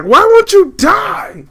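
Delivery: very high, slow, stretched villain scream; angry villain yell. Retold or imitated. Gamer quoting the boss mid-fight, in a shrill stretched villain scream. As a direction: shriek it like a furious villain losing a boss fight. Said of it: "he's just like".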